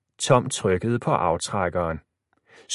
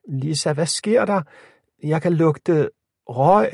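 put-on high, lightly frightened girl; small scared voice, pitched up; thin, frightened voice; 6 semitones higher, 4 dB louder than usual. A narrator's reading. Locked-in Emma smells smoke and calls Lisa, frightened. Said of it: "Emmas spinkle stemme lød skræmt".

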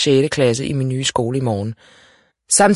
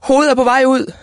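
high, loud, urgent cry; narrated scream from a mother in labour; 11 semitones higher, 5 dB louder than usual. A narrator's reading. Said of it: "skreg hun".

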